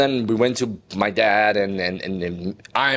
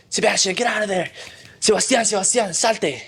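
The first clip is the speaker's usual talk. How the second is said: higher, fast urgent calling of a name; calling through a door; 10 semitones higher than usual. Retold or imitated. Mom calling her locked-in son, raised pitch, hurried.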